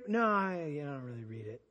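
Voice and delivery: imitating a sheepish answer, sinking low and soft; mock sheepish mumbled excuse; reluctant admission. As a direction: trail off into a low, half-swallowed confession. Voicing a reluctant counselee's answer.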